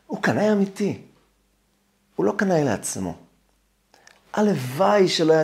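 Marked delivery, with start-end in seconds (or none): none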